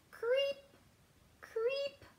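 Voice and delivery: creep, creep, high and rising; high creepy-crawly creep. Acted. Reading to children, high rising worm creeps. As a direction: tiptoe the words up like a little worm. Worm sound read aloud.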